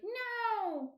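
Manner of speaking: high, drawn-out Australian "no"; Australian accent. Retold or imitated. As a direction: imitate an Australian accent on one long high word. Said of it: "I actually like the accent. It's like".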